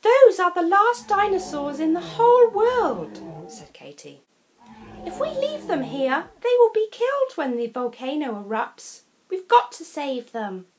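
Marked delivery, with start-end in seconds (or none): 0.0-3.2 tearful plea. A narrator's reading. Katie pleads for dinosaurs. 5.1-10.7 put-on higher, earnest girl's voice; pleading Katie. A narrator's reading.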